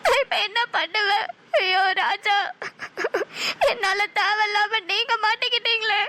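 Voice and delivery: tearful lament through sobs; weeping in despair at her own fault; crying. Acted. Alone on the road after her fiancé's arrest, crying.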